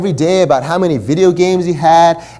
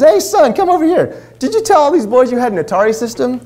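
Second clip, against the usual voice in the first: mom calling her son; 9 semitones higher than usual. Retold or imitated. Mom confronting her son at the door, high raised call. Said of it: "And then she says".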